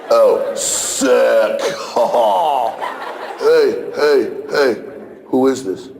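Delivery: put-on eighth grade boy on the phone. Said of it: "they go".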